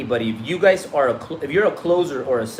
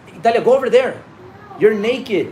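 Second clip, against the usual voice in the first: raised, higher dad voice; calling out; 7 semitones higher, 5 dB louder than usual. Real, not acted. Dad telling his naked daughter to go away.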